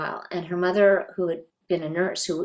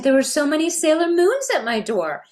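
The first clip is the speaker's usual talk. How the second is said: excited mom; 11 semitones higher, 6 dB louder than usual. Retold or imitated. Mother excitedly retells to daughter.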